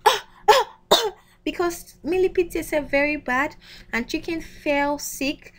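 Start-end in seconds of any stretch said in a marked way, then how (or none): 0.1-1.1 coughing noise. Acted. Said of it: "Chicken coughed Millipede out, like".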